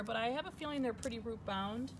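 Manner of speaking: normal talking voice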